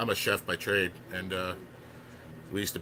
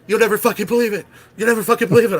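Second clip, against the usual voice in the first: much higher, loud, ecstatic yelling; retold excited screaming; 12 semitones higher, 11 dB louder than usual. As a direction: shriek it out like the best news ever. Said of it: "I just hear screaming".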